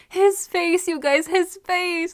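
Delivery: high-pitched fan swooning; excited gushing. Real, not acted. Fan swooning to viewers over a character's face, gushing. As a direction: gush in a high voice, stretching the words.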